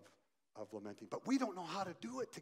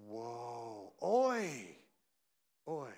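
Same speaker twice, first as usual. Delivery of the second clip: stunned dismay; 4 semitones lower, much slower than usual. Pretend. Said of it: "We will definitely go".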